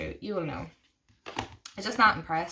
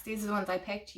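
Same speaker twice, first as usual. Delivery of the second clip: ordinary talk, nothing marked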